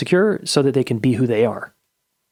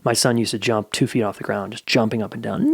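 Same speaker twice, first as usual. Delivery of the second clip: ordinary talk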